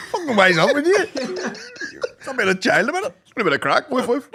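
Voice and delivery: mock gimp voice. Mimicking the gimp.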